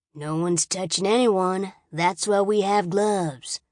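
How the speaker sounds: slow, drawn-out taunt; slow mocking. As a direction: stretch it out to mock her. Brady teasing her.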